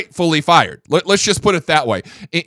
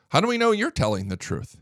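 ordinary talk

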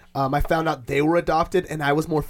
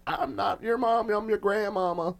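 grandma voice; 3 semitones higher than usual. Retold or imitated. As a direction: play the grandma confessing.

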